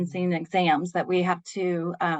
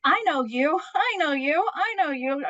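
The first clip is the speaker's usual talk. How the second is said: high, sing-song cockiness; retold cocky self-talk, lilting high; 9 semitones higher than usual. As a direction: greet each one with playful swagger, pitched well up. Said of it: "I got cocky. I'm like".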